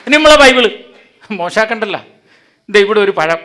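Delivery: loud, rousing pulpit delivery; preaching at full force. Real, not acted. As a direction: drive the point home to the crowd. (ആവേശത്തോടെ പ്രസംഗിക്കുന്നു)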